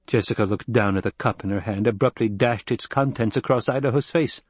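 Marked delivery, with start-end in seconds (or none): none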